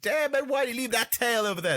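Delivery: high, fast mock amazement; hammed-up flirty squadmate. Podcaster imitating a soldier eyeing a woman, high hammed-up voice. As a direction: play the flirt, high and quick.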